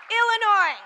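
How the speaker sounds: loud high call to the crowd; roaring rallying speech. Real, not acted. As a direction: shout the state's name to the arena.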